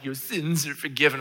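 affected, pompous pronouncement; hammed-up pious proclamation; mock reverent reading. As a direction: intone it grandly, as a parody. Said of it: "we always read this and we're like".

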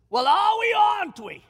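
high, loud rallying cry; defiant challenge. Retold or imitated. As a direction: throw the challenge at the crew. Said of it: "Just like that".

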